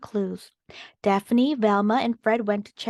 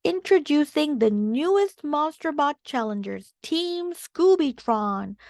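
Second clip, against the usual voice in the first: loudspeaker announcer; 5 semitones higher than usual. Acted. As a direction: call it out like an arena announcer. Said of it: "announced the next battle over the loudspeaker".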